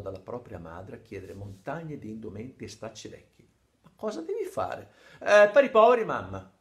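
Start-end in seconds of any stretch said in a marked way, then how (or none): 3.8-4.9 mother's voice, much higher, suspicious; a mother asking what her son is up to. Retold or imitated. Madre interroga Gianni di sera. 5.2-6.5 eager excuse. Retold or imitated. Gianni si giustifica con la madre.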